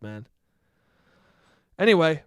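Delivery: ordinary delivery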